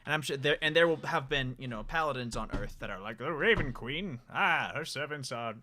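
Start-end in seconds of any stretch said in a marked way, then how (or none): 3.2-5.5 righteous holy warrior declaration; paladin voice. Shown as a demonstration. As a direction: proclaim it like a devout paladin. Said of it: "This is my paladin voice".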